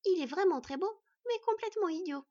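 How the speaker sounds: put-on high, loud child's verdict; childish teasing, pitched high; cheeky little girl. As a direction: judge him in a small fox girl's voice. Little girl teasing about the elf.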